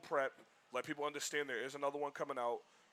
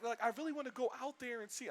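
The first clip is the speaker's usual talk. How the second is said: high-pitched imitation of keen fans; mock eager; 10 semitones higher than usual. Imitating people wanting to visit LA, mock eager.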